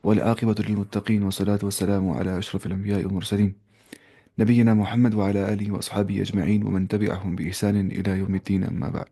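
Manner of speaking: a solemn formal prayer opening; formal Arabic invocation. Acted. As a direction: intone the opening praise like a sermon. Opening Arabic praise formula.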